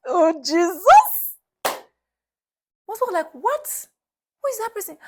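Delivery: retold amazed shriek; shocked outcry. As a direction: gasp it out in disbelief.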